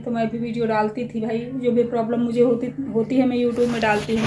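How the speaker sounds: ordinary delivery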